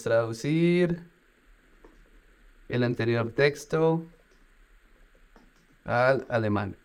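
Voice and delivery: drawn-out typing dictation; slow dictation. Shown as a demonstration. Dictating a translation request to the chatbot, slowly. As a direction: say it as you type it.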